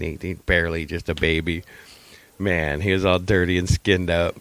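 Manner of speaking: normal talking voice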